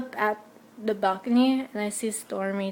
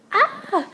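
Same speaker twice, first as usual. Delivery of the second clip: high imitated fan scream; retold fangirl scream; mock fan scream; 11 semitones higher, 5 dB louder than usual. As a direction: squeal like the screaming girls. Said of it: "they were screaming like crazy".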